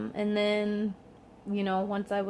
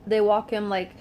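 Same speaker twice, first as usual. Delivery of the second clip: ordinary talk, nothing marked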